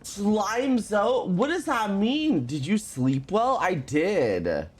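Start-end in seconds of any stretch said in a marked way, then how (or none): none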